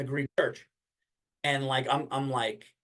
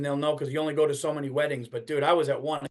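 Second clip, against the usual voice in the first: ordinary talk, nothing marked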